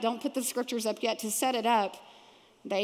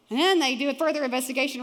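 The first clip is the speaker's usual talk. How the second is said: ordinary delivery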